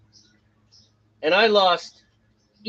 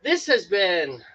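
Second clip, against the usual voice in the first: ordinary talk, nothing marked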